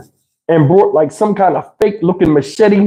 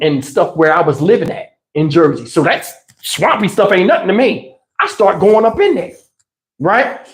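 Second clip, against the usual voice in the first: normal talking voice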